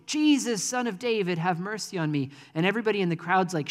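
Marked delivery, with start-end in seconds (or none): 0.1-2.3 higher, louder mock cry for help; imitated shouting. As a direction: call out like the blind beggar, fading back. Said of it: "and he starts shouting".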